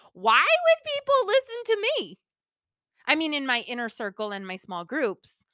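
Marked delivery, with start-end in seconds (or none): 0.1-2.2 high, incredulous mock wail; exaggerated self-doubt, pitched way up. Host mimicking her old insecure thoughts, self-doubting. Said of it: "Totally thought it. I was like".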